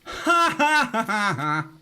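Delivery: high, loud burst of laughter; laughing at own joke; hearty real laugh. Real, not acted.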